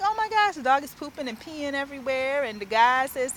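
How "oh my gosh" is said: shocked. Retold or imitated. Shocked at dog in facility.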